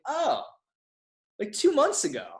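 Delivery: squeaky-high, hammed-up dawning realization; heightened sheepish realization. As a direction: replay the embarrassed answer way up high. Retold realisation, second time heightened.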